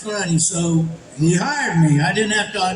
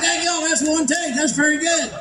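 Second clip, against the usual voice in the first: high-pitched pleased surprise; retold amazement; 7 semitones higher than usual. Voice actor reacts to nailing it first try, amazed. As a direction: marvel in a raised, drawn-up pitch.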